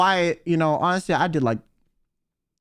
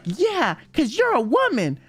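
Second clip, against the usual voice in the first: higher, playful retelling of the serious line; mock earnest, higher; 5 semitones higher than usual. Kyo repeats Rosemi's line, mock earnest and higher. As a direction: lift it up, teasing the memory. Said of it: "She was like".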